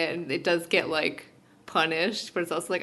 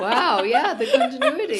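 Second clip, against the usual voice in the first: laughing, higher and louder; 8 semitones higher, 9 dB louder than usual. Real, not acted. Cohost joining in on the continuity joke, laughing. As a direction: echo it while laughing.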